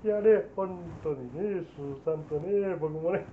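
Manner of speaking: low, soft, slow chuckling impression; celebrity impression. Shown as a demonstration. Comic showing off his mimicry, low chuckling impression. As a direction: murmur it low and slowly between chuckles. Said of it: "じゃあやってみろよ".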